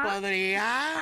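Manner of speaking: drawled fresa word. Retold or imitated. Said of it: "empieza a hablar fresa".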